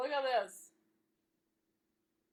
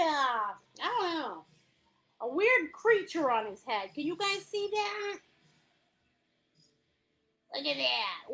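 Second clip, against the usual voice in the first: normal talking voice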